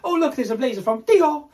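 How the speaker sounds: high, put-on fashion-spotter voice; affected brand-name voice. Mocking how one would mention a blazer, affected and high. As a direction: exaggerate it, ending on a showy "Dio". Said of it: "don't say it like that".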